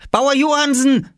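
high, loud call across the field; child shouting. Acted. Oskar calling to the farmer across the field, loud and high. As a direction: yell out as an eager little boy. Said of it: "schrie Oskar".

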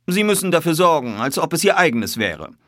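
high, loud, insistent; commanding detective; firm instruction. A narrator's reading. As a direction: lay down the terms to him. Detective gives firm orders.

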